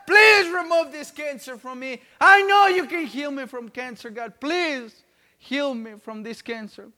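loud, high plea to God, easing at the end; retold as a pleading cry to God; desperate prayer. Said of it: "praying every day to God and asking".

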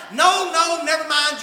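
high, loud protest from the wife; wife's loud retort. Retold or imitated. Wife backing down, voiced loud.

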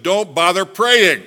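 loud, high and slow; emphatic warning. Real, not acted. Preacher warns husbands who mistreat wives, loud and slow. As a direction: hammer it home slowly.

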